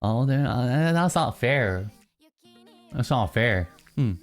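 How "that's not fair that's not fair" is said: ordinary talk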